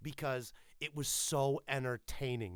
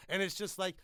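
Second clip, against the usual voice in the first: usual speaking voice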